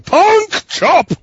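high, loud mock battle cry; C M Punk. Co-host acting out a wrestler chasing a manager, high mock shout. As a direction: shout it like a cartoon villain chasing someone. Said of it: "C M Punk's chasing him going".

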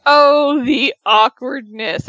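ordinary talk

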